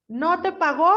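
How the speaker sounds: teacher demoing a question with surprise; surprised question. Shown as a demonstration. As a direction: ask it as if shocked. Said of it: "Con una sorpresa así".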